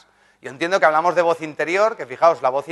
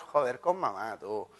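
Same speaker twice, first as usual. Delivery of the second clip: muttered aside; 5 semitones lower, 7 dB quieter, slower than usual. Retold or imitated. Siblings muttering to each other.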